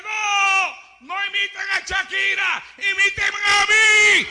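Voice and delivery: high, loud, crying shout; Christ pleading. Acted. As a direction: beg them to follow him instead. Christ correcting the crowd.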